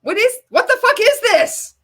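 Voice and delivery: almost shouting at the screen in disbelief; incredulous outburst. Real, not acted. Reacting to an absurd video, a loud incredulous yell.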